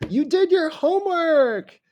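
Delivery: very high, loud cheer; over-the-top squeal of congratulation; yay, hooray. Shown as a demonstration. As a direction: celebrate it in a high-pitched shout. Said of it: "Yay, hooray".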